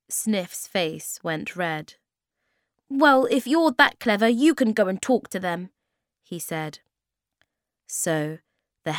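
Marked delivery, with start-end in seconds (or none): 2.9-5.7 loud, raised-pitch challenge; stung and loud; huffy retort. A narrator's reading. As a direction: snap back crossly at a know-all. Sniff retorts, face gone red.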